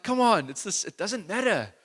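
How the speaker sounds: high-pitched mock exasperation; irritated. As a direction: plead like a mildly fed-up parent. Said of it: "get all irritated".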